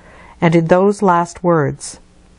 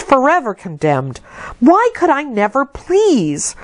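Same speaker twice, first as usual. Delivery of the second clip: rising, pleading cries of injustice, pitched higher; anguished protest; 6 semitones higher than usual. A narrator's reading. Locked-up Jane railing inwardly at her cousins' favour, high anguished protest. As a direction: pour out a child's bitter grievance, climbing higher. Jane's inner outcry at injustice.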